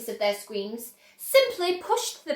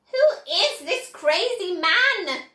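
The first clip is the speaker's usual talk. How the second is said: mild scream; 6 semitones higher, 5 dB louder, slower than usual. A narrator's reading. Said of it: "screamed Grandma Josephine".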